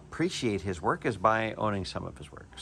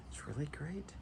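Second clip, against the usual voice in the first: breathy hushed whisper of admiration; whispered awe; 4 semitones higher, 12 dB quieter than usual. Real, not acted. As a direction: whisper it as if the blade is too fine to disturb. Admiring the blade up close.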